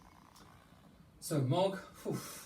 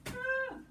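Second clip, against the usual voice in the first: scream. Acted. Repeat of Debbie's scream.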